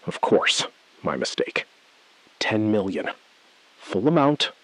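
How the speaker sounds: ordinary talk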